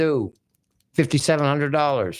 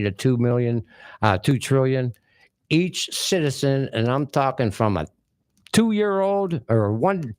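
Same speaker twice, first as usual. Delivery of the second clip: usual speaking voice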